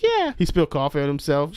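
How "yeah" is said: put-on girly yes. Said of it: "She's like".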